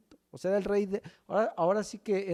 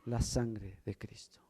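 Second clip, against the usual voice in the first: hushed whisper on the blood of Christ; 7 semitones lower, 8 dB quieter than usual. Real, not acted. Preacher to his congregation, a reverent whisper.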